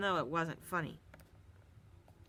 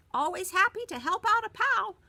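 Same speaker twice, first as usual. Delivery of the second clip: high, cheerful squeak; squeaky hamster; 13 semitones higher, 7 dB louder than usual. A narrator's reading. Hamster Humphrey cheerfully squeaks to his pal. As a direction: chirp it like a hamster. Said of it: "I squeaked".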